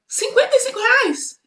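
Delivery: high, disbelieving repeat of the price; boy shocked at what the shopkeeper asks; shocked question. A narrator's reading. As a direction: gasp at the price.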